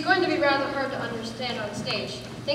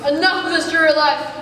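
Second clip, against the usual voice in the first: loud, higher-pitched theatrical despair; melodramatic declaiming; 4 semitones higher, 9 dB louder than usual. Acted. Hero acts out the writer's line, melodramatic. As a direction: declaim it like a tragic stage hero.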